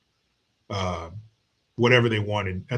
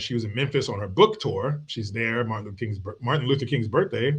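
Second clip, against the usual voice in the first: normal talking voice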